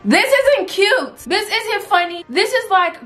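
höher und lauter, genervt; laut die eigene Peinlichkeit anprangern; irritated. Real, not acted. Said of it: "I'm getting so irritated".

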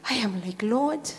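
low, near-whispered reverence; hushed, emotional preaching. Real, not acted. Pastor moved by Mary's love of God, hushed preaching. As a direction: pray and appeal in a very soft, low voice.

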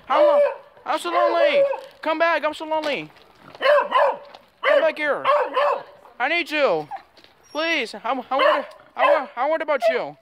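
high, pleading puppy cry; whining dog voice. Pretend. Dog begging boy to return.

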